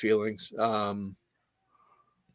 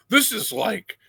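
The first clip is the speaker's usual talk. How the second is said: Hulk Hogan pitch; 3 semitones higher than usual. Retold or imitated. [name] pitching the jump like Hulk Hogan, mock promo voice. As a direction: ham up the wrestling promo.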